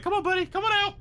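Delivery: very high, loud wheedling call; mock coaxing. Player pretending to coax it back out, mock coaxing. Acted coaxing, wiggling a finger.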